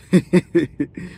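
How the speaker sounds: quick giggling burst of laughter; amused laugh. Real, not acted. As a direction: laugh out loud at the story. Reader reacts to the story.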